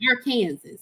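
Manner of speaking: Arkansas said ar-KAN-zis; spelling pronunciation. Shown as a demonstration. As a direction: say Arkansas like Kansas with "ar" before it. Demonstrating two ways to say it.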